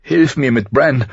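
high, pressing plea; urgent call. A narrator's reading. Mother asking her son for help with Bran, urgent call. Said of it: "rief sie drängend".

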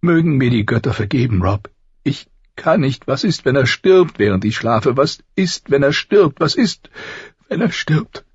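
high, tearful, frantic repeated pleading; weeping. A narrator's reading. Mother afraid her boy dies while she sleeps, weeping. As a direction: plead through tears, pitched well up. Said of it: "weinte sie".

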